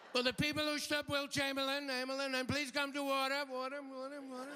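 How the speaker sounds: high echoing PA call fading out; arena announcer. Pretend. Convention announcer paging the women over the PA with echo. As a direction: boom it through a stadium loudspeaker.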